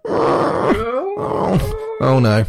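very high, squeaky whimpering wallet; crying wallet voice. Pretend. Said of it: "I can hear my wallet going".

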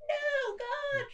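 very high, soft wail; hammed-up John losing hope; mock despairing John. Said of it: "John's like".

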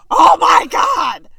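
loud, slow, shocked shout. Retold or imitated. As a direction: scream it in shock. Witnessing crash, shout heard.

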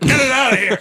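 sudden loud high bark of a shout; a sharp, loud, high-pitched mock yell; mock angry shout. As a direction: throw it out with a hammed-up yell. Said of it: "break the pot and be like".

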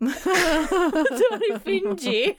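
laughing, slow, drawn-out mock British "Midori Fuji"; mock British accent. Co-host laughing, slowly mimics the name in a mock British accent. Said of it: "I can't even do it with an accent".

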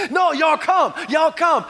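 mock-pleading invitation to the congregation; eager urging. Acts out himself urging people in.

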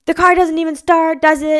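high, loud, put-on voice of a testy driver; accusing tow driver. Tow truck driver doubting her car starts, loud mock grump.

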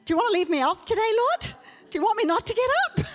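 high, fast playful plea; mock plea to God. Pastor jokingly acting her plea to the Lord, high and fast. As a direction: rattle off a cheeky plea. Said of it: "And I'm like".

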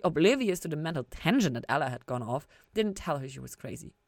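ordinary delivery

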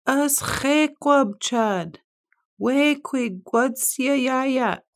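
high slow called-out question; hollering. A narrator's reading. Beaver hollering to the far shore. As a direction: call across the pond, drawn out.

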